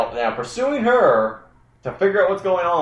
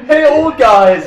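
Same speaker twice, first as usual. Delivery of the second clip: panicked Harkin shout; 11 semitones higher, 8 dB louder, slower than usual. Retold or imitated. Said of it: "run and go".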